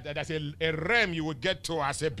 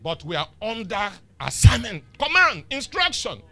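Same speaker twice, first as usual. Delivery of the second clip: louder preaching, rising on the last words; 4 semitones higher, 8 dB louder than usual. Acted. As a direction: drive home the command. Preaching at full force.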